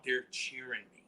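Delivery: ordinary talk, nothing marked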